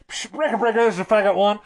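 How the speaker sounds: crackly CB radio voice; radio effect. Shown as a demonstration. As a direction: talk like over a walkie-talkie. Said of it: "I put in a radio effect".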